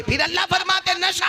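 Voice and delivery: very high, held final word; ringing pulpit cry. Real, not acted. Preacher rousing congregation against intoxication, impassioned cry. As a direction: cry out and stretch the last word.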